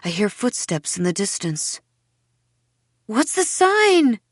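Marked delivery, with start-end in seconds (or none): 3.0-4.2 high, drawn-out desperate cry; shouted. A narrator's reading. Girl shouting to Seth as he slips away, shouted. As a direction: call it out loud, very high and slow. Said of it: "I shout to Tom Seth".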